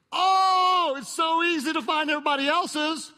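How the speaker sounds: high, loud mock exclamation. Preacher mocking how easily we judge others, high sarcastic burst.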